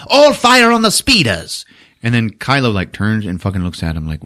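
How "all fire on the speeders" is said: high, loud, shrieked mock command; Hux echoing the order. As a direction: parrot the order far too eagerly. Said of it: "And then Hux just goes".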